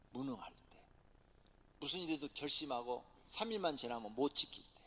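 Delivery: normal talking voice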